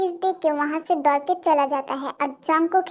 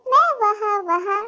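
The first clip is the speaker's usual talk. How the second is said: put-on lovestruck girl; 5 semitones higher than usual. Girl declares she follows crush everywhere, lovestruck.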